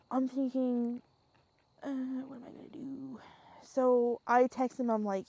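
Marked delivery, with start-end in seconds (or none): 1.8-3.2 whispered thought. Retold or imitated. Panicked thought at the tantrum.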